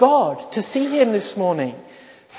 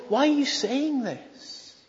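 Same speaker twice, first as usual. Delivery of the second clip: incredulous; 7 semitones higher, 6 dB quieter than usual. Retold or imitated. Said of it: "Can you hear the incredulity".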